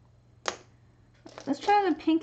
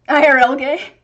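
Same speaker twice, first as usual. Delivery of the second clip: much louder, amused and incredulous; laughing disbelief; 13 dB louder than usual. Real, not acted. Streamer reading out girl in Pig Latin, laughing in disbelief. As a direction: burst out loud through a laugh.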